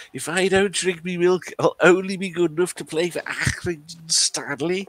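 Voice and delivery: put-on high-pitched Scouse kid; high boyish advert impression; milk advert schoolboy. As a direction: play a little lad quoting his football hero, high. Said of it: "Ian Rush says".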